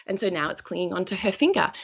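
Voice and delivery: ordinary talk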